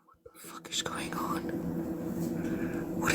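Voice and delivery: hushed bewildered whisper. Real, not acted. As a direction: breathe it, lost. Hushed confusion.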